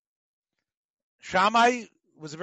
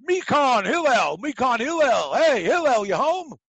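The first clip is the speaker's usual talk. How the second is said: loud, higher calling up to the house; screaming up; 5 semitones higher, 5 dB louder than usual. Retold or imitated. Man calling Hillel out of his house, shouted loud and high. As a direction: yell up at the window for Hillel. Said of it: "he screamed up".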